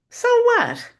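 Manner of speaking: high, loud and airy; carefree high shrug of a line; breezy dismissive. Acted. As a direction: toss it off way up high.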